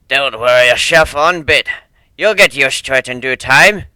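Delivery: calm a frightened stranger roughly but kindly; gruff sea dog. Acted. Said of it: "tried to reassure her".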